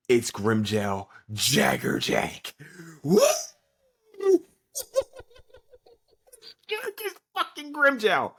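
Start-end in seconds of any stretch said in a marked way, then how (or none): 3.0-4.4 sudden high, loud shriek of disbelief; shocked what. Real, not acted. Shocked at Grimmjow's return. 6.7-8.3 high, slow, stretched fan squeal; hyped disbelief. Real, not acted. Hyped reveal.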